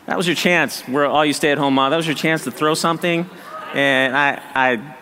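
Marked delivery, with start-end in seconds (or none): none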